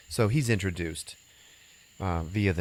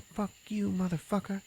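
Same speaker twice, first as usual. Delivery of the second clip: put-on much higher, softer snotty girl voice; bratty schoolgirl; 12 semitones higher, 5 dB quieter than usual. Shoplifting schoolgirl cursing at Joe, high snotty squeak. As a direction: squeak it out like a nasty little brat. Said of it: "And she's like".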